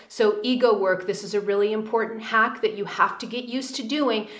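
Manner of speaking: usual speaking voice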